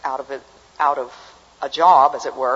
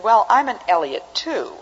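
playful facetious retort, pitched a bit higher; 5 semitones higher, 4 dB louder than usual. Retold or imitated. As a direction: say it facetiously, as a joking introduction. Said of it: "He said this facetiously".

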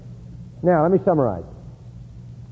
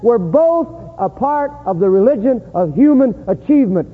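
raised, higher-pitched sermon delivery; impassioned preaching; 7 semitones higher than usual. Real, not acted. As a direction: drive the point home from the pulpit. Preaches the contrast forcefully.

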